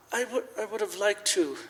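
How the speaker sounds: a man choking up, unable to finish; faltering. Acted. Scrooge stopping himself mid-thought, soft faltering trail-off.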